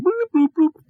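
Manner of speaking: squeaky high computer chirps; high bleep bloop computer sounds. Acted. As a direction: chirp it like a cartoon console beeping. Hailing sound again.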